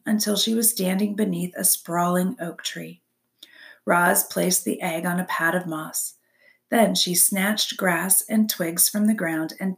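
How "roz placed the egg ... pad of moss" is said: plain reading voice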